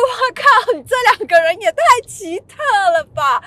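high, loud stunned "wow, what the"; stunned disbelief. Retold or imitated. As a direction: blurt out amazement at these weirdos. Inner shock at strange fans.

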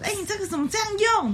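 high, fast mocking fuss; mock fussy coworker. Imitating the coworker who makes a fuss, high and fast. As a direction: parody an overreacting colleague. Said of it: "就大声嚷".

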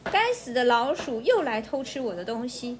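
ordinary delivery

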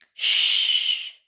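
loud, long shush; hushing shush. A narrator's reading. Silencing talk of mutiny, urgent shush. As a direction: shush them sharply with pure breath.

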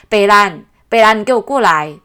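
loud mock teacher calling a child over; shouting the child's name to come here; teacher scolding a kid. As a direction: bark out the mangled name like a cross teacher. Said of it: "都会叫做".

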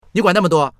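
high, loud and irritated; snappish retort. Acted. Woman to classmate prying about pregnancy, loud snap. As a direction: snap back at a nosy question.

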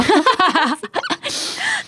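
high amused laugh breaking out; bright laugh. Real, not acted. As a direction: laugh at her own vision. Laughs at seeing herself rich.

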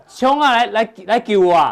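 loud, slow mock cry of "来救我啊"; hammed-up plea to be rescued; 夸张地大喊救命; mock call for help. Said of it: "要喊".

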